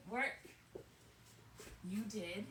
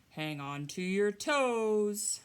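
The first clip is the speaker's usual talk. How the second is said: bear voice; 11 dB louder, slower than usual. Acted. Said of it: "he says".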